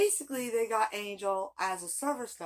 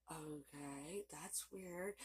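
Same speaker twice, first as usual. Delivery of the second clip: soft, puzzled okay under the breath; puzzled mumble; 6 semitones lower, 18 dB quieter, much slower than usual. Retold or imitated. Her reply to dad's odd order, in a soft puzzled mumble. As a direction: mutter it, confused by the odd order.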